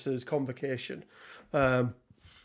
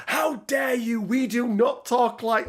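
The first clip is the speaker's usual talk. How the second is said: high, raised-voice imitation of outrage; mock ballistic outrage; 8 semitones higher than usual. Woman rounding on the prankster, mock indignant shout. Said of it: "goes fucking ballistic with him".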